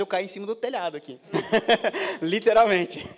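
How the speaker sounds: real laugh breaking into the words; amused laughing. Real, not acted. Joking about living right next door.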